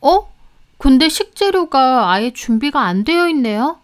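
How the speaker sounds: a hint of fake surprise; mildly surprised maid. A narrator's reading. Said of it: "송혜연이 좀 놀라더라고요".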